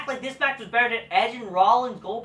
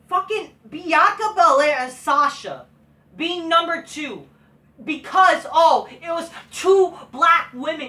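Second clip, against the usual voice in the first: louder, higher, heated rant building up; angry rant; 4 semitones higher, 6 dB louder than usual. Real, not acted. Vlogger ranting at the match rankings, loud and angry. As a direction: let the outrage boil over.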